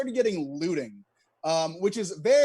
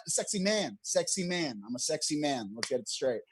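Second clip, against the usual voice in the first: mock seductive; 6 semitones lower, 5 dB quieter than usual. Mock sexy voice, getting lower.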